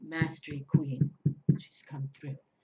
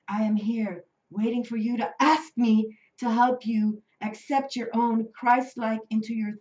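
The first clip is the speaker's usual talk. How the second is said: channeled message read; 4 semitones higher, 9 dB louder than usual. Acted. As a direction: deliver the angel's words reverently. Reads the angel's message.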